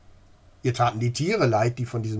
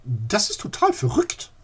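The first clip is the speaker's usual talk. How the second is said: high, loud exclamation; old lady overwhelmed, crying out; exclaiming; 9 semitones higher, 5 dB louder than usual. Acted. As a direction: cry it out in shock. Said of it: "rief sie aus".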